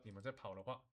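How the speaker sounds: ordinary delivery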